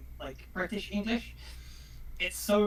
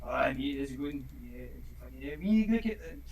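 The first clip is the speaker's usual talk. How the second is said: Scottish accent; 7 semitones lower, 4 dB quieter than usual. Shown as a demonstration. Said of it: "it goes like this".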